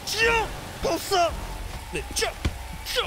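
ordinary delivery

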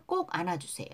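ordinary talk, nothing marked

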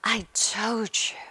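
gasping. A narrator's reading. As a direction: labour the words out through a stitch. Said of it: "Hermione gasped, clutching at the stitch".

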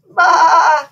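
loud, high drawn-out bleat; goat bleat. A narrator's reading. Goat answers the king and queen, loud high bleat. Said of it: "said the goat".

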